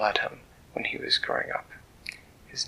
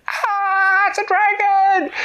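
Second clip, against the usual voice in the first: much higher, louder alarmed cry; panicked guard cry; 9 dB louder, much slower than usual. Acted. Castle guard seeing the dragon at the door, panicked shriek.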